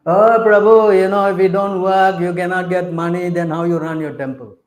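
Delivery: loud, sing-song mimic of a member's excuse; mocking a worldly devotee. Preacher mocking the congregation's excuse, sing-song. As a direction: send up the money-minded excuse. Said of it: "They think like that".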